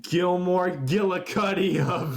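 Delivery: ordinary talk, nothing marked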